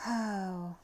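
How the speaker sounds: usual speaking voice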